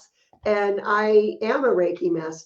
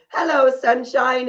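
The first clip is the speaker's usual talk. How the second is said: cheery greeting; 5 semitones higher, 5 dB louder than usual. Retold or imitated. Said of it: "Every morning I would say".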